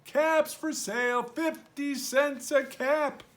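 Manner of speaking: high, loud peddler's cry; street calling. Acted. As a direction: call out wares down the street. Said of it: "he walked back to town calling".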